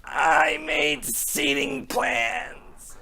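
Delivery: slow, faint dying voice; mock dying words, drawn out; mock deathbed regret. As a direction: whisper it out like your last breath. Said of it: "in your deathbed going".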